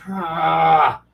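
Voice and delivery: long, loud groan of frustration; exasperated groan. Retold or imitated. Game master worn out by player, exasperated groan. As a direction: groan as if utterly fed up.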